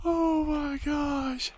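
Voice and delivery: short of breath up high; gasping for air on the summit; breathless at high altitude. Real, not acted. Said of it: "it is hard to breathe up here".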